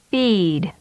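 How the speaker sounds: "Feed." with the ee held long; drawn-out "Feed."; long vowel. Shown as a demonstration. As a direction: stretch the ee of "Feed.". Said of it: "and then with a longer duration".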